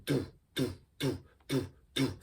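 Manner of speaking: soft, quick do beats with puckered lips; quick do kick. Shown as a demonstration. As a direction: quietly pop out quick do beats. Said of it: "like you're saying do really quickly".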